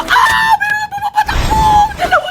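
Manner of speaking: high, startled shout; sudden gamer alarm. Real, not acted. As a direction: cry out as the enemy charges. Enemy chasing in game.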